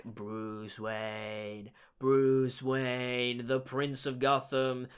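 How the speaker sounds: low, slow, droning menace; creepy interrogation-room villain voice; Riddler impression. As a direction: draw the name out low and softly. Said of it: "in that interrogation scene, he's all just like".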